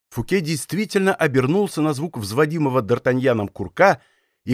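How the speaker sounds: ordinary delivery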